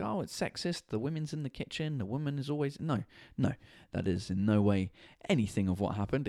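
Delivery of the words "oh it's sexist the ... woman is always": a hint of a whiny critic, higher and softer; mocking critics. Podcaster mocks imagined critics in a lightly whiny voice. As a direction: lightly mimic the scolding critics. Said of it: "people who might be like".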